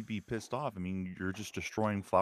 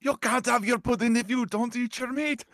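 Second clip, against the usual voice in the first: much higher, shouting mock Scottish schoolmaster; 4 dB louder than usual. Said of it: "the school teacher from Pink Floyd".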